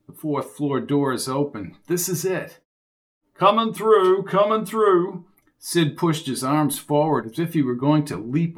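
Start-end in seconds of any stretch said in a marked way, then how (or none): 3.3-5.3 calling out. Acted. Sid pushing through crowded elevator.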